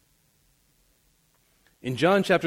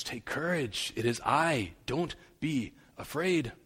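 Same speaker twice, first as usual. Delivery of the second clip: soft reassurance; 8 dB quieter than usual. Acted. Jesus comforts, very soft.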